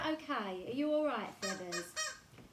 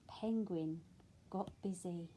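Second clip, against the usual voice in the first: put-on reading to children; 4 dB louder, slower than usual. A narrator's reading. Reads picture book aloud.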